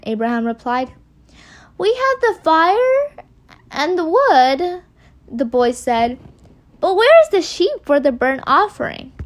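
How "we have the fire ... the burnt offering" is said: put-on higher, drawn-out child's question; lightly voiced wondering boy. A narrator's reading. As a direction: read the boy's lines high and puzzled. Boy questions his father in story.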